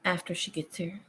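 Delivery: usual speaking voice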